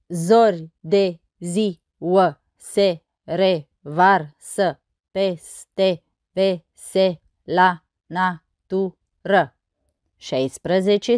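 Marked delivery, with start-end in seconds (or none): 0.1-9.5 very slow, each syllable separated. Shown as a demonstration. Teacher counting verse syllables on fingers, syllable by syllable. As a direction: count out the syllables one at a time. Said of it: "Și să nu uităm de degete".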